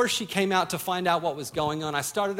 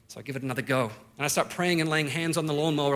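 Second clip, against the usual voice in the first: ordinary delivery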